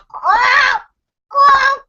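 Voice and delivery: high, loud shouted quacks; mock duck quack. Grandpa calling out like a duck, high and loud. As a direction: quack like a duck through cupped hands. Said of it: "cups his hands around his mouth and goes".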